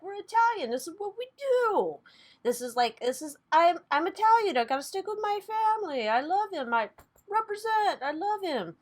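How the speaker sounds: high, proud, pumped-up boast; Cuomo brothers impression. Mocking the Cuomo brothers bragging about family, high and proud. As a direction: puff up and brag like a proud Italian brother. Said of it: "the Cuomo brothers kinda did that".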